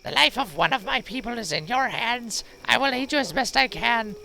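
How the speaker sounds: high, put-on old crone voice; old wise woman voice.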